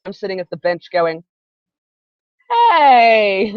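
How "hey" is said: loud, higher, long-drawn mock hey; protesting call. Friend left sitting at the bench, mock indignant call. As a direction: act out calling from the sidelines.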